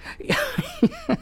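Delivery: high burst of laughter. Real, not acted. Laughs at own bit.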